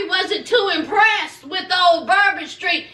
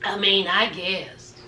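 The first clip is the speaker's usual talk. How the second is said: much lower, soft reluctant mumble; a hint of a grudging husband; deflated husband; 11 semitones lower, 5 dB quieter than usual. Husband reluctantly giving in to wife, low and soft.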